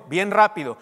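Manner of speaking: ordinary delivery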